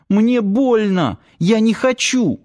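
high, stretched cries of protest; pained pleading. A narrator's reading. Girl hurt by attacker protesting, pained and pleading.

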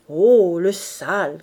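a loud scandalised Oh; ladies crying out. Acted. The ladies shrieking at Boche's crude joke, loud outcry. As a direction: cry out in mock disgust. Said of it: "crièrent les dames".

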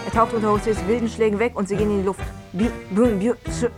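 ordinary talk, nothing marked

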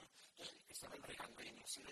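ordinary talk

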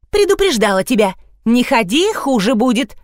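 plain reading voice

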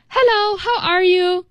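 high, cheerful greeting; happy. Shown as a demonstration. Teacher showing how tone reveals feeling, happy greeting. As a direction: greet them in a demonstratively happy tone. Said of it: "then I sound pretty happy".

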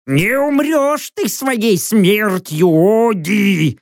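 high, slow, spiteful bird cry; malicious drawn-out cawing; angry owl screech. A narrator's reading. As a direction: screech a curse like an old witch's owl. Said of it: "зло прокликотала сова".

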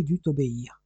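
plain reading voice

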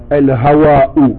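slow al-hawa'u, lam sounded; al-hawa'u said slowly. Shown as a demonstration. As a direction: model al-hawa'u for the pupil, slowly. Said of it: "On dit". (al-hawa'u lentement pour l'élève)

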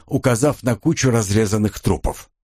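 ordinary delivery, nothing marked

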